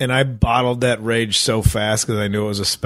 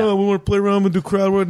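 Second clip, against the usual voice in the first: high, fast, sarcastic mimic; mock the people who push crowd work; 8 semitones higher, faster than usual. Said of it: "mocking advice".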